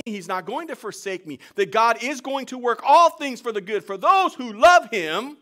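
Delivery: loud, high, ringing sermon voice; full-force preaching. Acted. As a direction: preach it out to the whole church.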